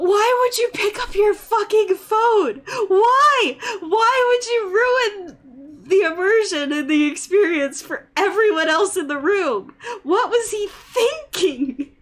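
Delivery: high, loud, wailing disbelief; exasperated outrage. Real, not acted. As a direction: plead in anguished, over-the-top exasperation. Venting about man answering phone.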